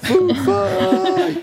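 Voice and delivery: high, loud, drawn-out shout; mock rallying yell. Player echoes the riot cry, mock yell. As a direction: yell it as a joke battle cry.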